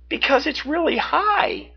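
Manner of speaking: put-on high, worried girl; nervous, looking up. A narrator's reading. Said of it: "she answered".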